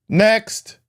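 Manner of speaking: high sing-song call; calling out next. Retold or imitated. Agent calling the next traveler, high sing-song call. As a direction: call the queue up without looking.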